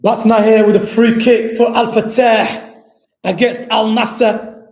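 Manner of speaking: live commentary. Real, not acted. Live play-by-play.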